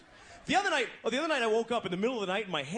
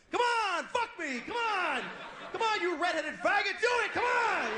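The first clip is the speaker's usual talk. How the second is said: high loud pushy yelling; demanding yell; 12 semitones higher, 4 dB louder, slower than usual. Retold or imitated. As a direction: egg him on hard, shouting.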